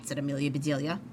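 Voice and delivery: plain reading voice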